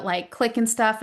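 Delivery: ordinary talk, nothing marked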